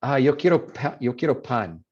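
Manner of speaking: gringo accent with a puffed P in pan; Spanish in an American accent, explosive p; English-accented Spanish. Shown as a demonstration. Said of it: "that's where an accent comes out".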